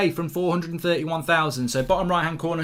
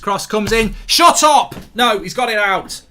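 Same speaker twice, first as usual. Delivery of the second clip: excited shout; 5 semitones higher, 8 dB louder than usual. Real, not acted. Live chance during match stream.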